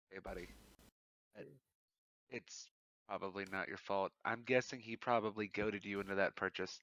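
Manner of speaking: hushed, halting reassurance; low-key, careful comfort; gentle consoling. Acted. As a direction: softly talk down someone who is upset. Consoling a crying character gently.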